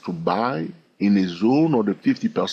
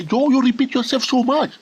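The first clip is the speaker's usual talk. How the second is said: high mock voice of a viewer; a high, mocking impression of a viewer; imitating a complaining viewer; 12 semitones higher than usual. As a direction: squeak out the viewer's complaint in a put-on voice. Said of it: "today I say".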